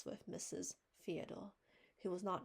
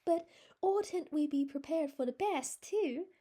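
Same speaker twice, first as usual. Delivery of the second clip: high, louder earnest plea; pleading; 9 semitones higher, 11 dB louder than usual. A narrator's reading. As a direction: beg her to hope for the best. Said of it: "pleaded Anne".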